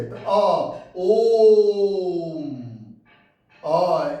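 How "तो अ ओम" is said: ओम drawn out long and loud, chanted; prolonged pluta vowel. Shown as a demonstration. Teacher demonstrating pluta to children, long chanted ओम. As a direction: stretch ओम to three beats like a Sanskrit chant. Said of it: "ज़्यादा संस्कृत में होता है ऐसे".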